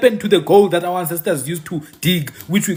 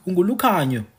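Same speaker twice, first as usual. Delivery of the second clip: normal talking voice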